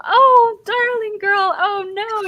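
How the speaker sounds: very high, loud gushing dismay; hammed-up sobbing fuss. Temple women swarm a bedraggled visitor, mock-tearful high fussing. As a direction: fuss over a poor darling, pitched sky-high. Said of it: "some of them are just sobbing over you".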